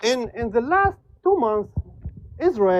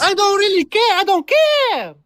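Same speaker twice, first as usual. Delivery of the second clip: much higher, cartoonish squeak; pitched way up like a cartoon mouse; squeaky high; 10 semitones higher, slower than usual. Real, not acted. Guest dismisses the question in a squeaky high voice. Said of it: "He does sound like Mickey Mouse".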